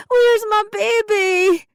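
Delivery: very high, loud, slow panicked scream. A narrator's reading. Mother losing her baby from sight, screaming. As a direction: scream in desperation. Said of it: "I screamed over and over".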